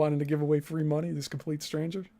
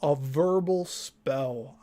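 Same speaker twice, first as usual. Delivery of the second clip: ordinary talk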